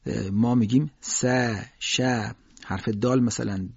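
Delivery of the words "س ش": "س، ش" with a thin fronted "a"; separate, thin demonstration syllables; light letters. Shown as a demonstration. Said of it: "استفال رو دارن".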